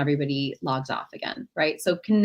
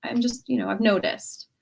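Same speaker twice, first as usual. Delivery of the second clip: much higher, gentle concern; caring check-in; 6 semitones higher than usual. Shown as a demonstration. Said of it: "You might say".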